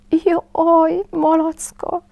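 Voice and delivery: little Roo calls out in fright, high and loud; high cry of alarm. A narrator's reading. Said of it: "kiáltotta".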